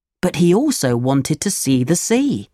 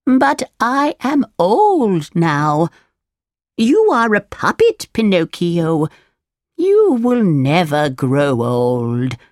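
put-on slow, drawn-out old woman voice; theatrical old fairy, stretched words; old fairy, declaring; 3 semitones higher, slower than usual. A narrator's reading. Fairy explains ageing to Pinocchio, slow old woman voice.

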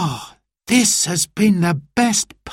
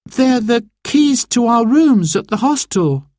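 confused reply; 13 semitones higher than usual. A narrator's reading. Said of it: "replied Simon, confused".

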